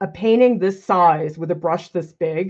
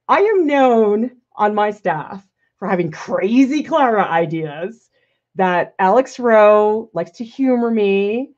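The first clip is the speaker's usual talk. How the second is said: normal talking voice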